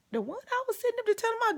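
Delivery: high, quick panicky squeak; mock panic. Retelling to friends how scared she got, high panicky squeak. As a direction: talk fast in a frightened little squeal. Panicking at the crazy girl story.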